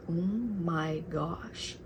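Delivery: very slow, drawn-out disbelief; stunned. Retold or imitated. Cousins hearing a stranger's threat, stunned. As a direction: stretch each word out in shock.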